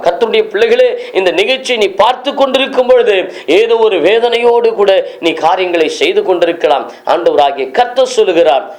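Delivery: ordinary delivery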